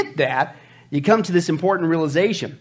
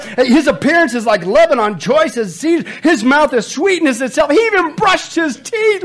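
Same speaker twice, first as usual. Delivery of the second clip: loud, high, hammed-up rapture; impassioned scripture reading; 9 semitones higher, 7 dB louder than usual. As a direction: build from declaimed verse to excited hollering. Reads poem, adds excited comments.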